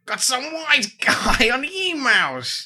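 high, drawn-out mock New York voice; New York accent. Host on a New York listener's email, mock New York accent. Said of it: "Shall I read it in that voice?".

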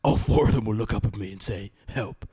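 put-on brooding vigilante monologue; mock Watchmen narrator. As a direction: narrate like a grim masked avenger.

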